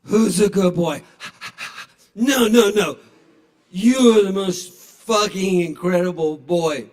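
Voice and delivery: put-on loud, earnest drunk gushing; drunk sincerity. Drunk patron pouring his heart out to a dog. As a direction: insist the dog is the best, loudly.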